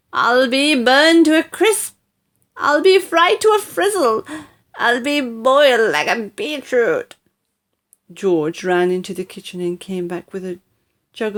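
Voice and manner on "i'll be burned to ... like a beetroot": panicked wailing. Acted.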